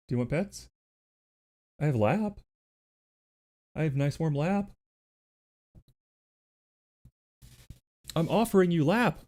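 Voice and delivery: talking to the cat, mock baby voice. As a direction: coax the cat in a high little voice.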